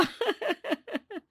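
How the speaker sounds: soft, high giggle; little high-pitched titter; pleased giggle. Acted. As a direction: giggle lightly at being looked after. Pleased laugh after compliment.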